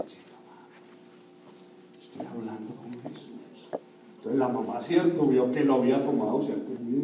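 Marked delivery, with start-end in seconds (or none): 0.1-3.7 barely voiced, near-whispered hush; hushed child. Praying little girl to her mother, hushed. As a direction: imitate a child quietly shushing mum.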